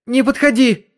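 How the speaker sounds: high, loud scream of fear; shouting. A narrator's reading. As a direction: cry out in panic. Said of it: "крикнула она".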